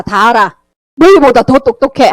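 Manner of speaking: ordinary talk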